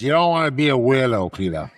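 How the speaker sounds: put-on thick Boston dad accent. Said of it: "that bad of a Boston accent".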